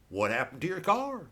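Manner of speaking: normal talking voice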